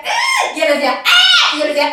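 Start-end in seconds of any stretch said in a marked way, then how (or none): 0.1-0.6 very high squealed scream; reenacted squeal. Reenacts her scream back. 1.1-1.6 high, loud mock scream; imitated answering scream.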